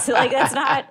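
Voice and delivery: real laughter breaking through the words; laughing. Real, not acted. Co-host amused by the idea of cookie allergies, laughing. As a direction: crack up while trying to finish the point. Cracking up at own absurd point.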